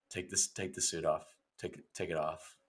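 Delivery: hushed, dejected Mario actor; solemn. Acted. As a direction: say it quietly, as if defeated. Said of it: "he's, like, kinda solemn".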